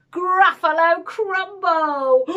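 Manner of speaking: relishing, drawn-out threat; bold threat. Acted. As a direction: announce the dish slowly, to scare the monster. Mouse threatens to eat Gruffalo.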